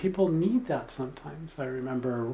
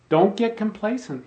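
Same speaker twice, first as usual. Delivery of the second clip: raised, louder imitation of a swami's warning; rousing rebuke; 7 semitones higher, 5 dB louder than usual. Swami warning a complacent disciple, rousing rebuke. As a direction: jolt him with a sharp warning. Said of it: "he was waking him up".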